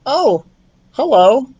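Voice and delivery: high squeaky mouse voice; startled little mouse caught out; high mouse, a touch surprised. Acted.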